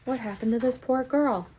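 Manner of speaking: mock a solemn heroine, pitching it up; hammed-up noble resolve; mock-earnest April. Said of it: "she's just like".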